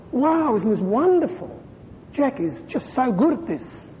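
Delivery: high-pitched, wowed praise of someone else; delighted admiration. Acted. Church speaker voicing people thrilled at another's gift, delighted admiration. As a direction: gush in wonder, pitch lifted high.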